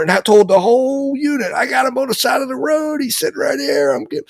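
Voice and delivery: high, near-shouted retold rant; imitating dad's excited yelling. Father ranting to his son about the trooper, excited yelling. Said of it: "he's yelling, but not in a bad way".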